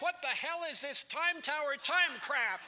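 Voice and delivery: very high, loud outraged yell; hammed-up screaming boss; furious program director. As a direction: explode at an employee on air. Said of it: "and he was furious".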